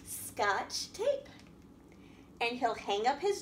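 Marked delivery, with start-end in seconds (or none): none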